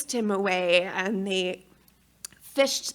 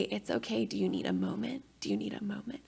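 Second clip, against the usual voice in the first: much softer, gentle reassurance; soothing doula; 10 dB quieter, faster than usual. Retold or imitated. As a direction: hush her kindly. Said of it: "my doula is trying to say".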